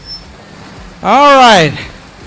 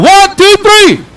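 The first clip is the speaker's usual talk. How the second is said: shouted countdown; 9 semitones higher, 3 dB louder, slower than usual. Real, not acted. Host giving the crowd one last loud countdown. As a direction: count climbing to a high shout.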